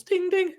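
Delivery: high chiming ding; elevator ding. Acted. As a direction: sing it like a bell. GM imitates elevator chime.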